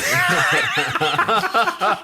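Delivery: sudden high cackle; loud burst of laughter. Real, not acted. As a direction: crack up loudly. Mocked in listener letter.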